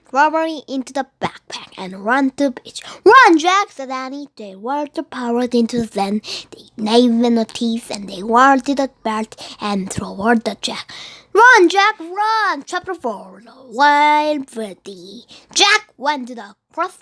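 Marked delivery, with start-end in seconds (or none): 3.0-3.7 loud high shout to run; panicked call; urgent shout. Acted. Sister warning brother. 11.3-12.6 loud high shout; frantic call; urgent shout. Acted. Sister warning brother.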